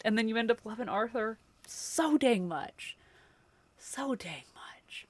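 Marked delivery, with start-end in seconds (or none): 3.8-5.1 slow soft whispered echo. Real, not acted. Telling chat how much she loved Arthur, slow whisper. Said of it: "soft whispered repeat".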